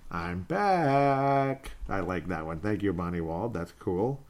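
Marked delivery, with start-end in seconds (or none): 0.1-1.7 higher, stretched-out singsong announcement; mock triumphant return, held long; shimmying celebration. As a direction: draw out the last word playfully. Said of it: "doing the shoulder shimmy, saying".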